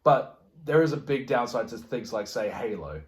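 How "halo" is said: ordinary talk, nothing marked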